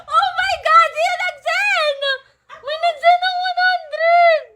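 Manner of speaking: high, thrilled cries of disbelief; excited squealing. Real, not acted. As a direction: squeal in amazement at a surprise gift. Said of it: "Pinasigaw mo ako ng ganon-ganon". (patiling pagkagulat sa tuwa)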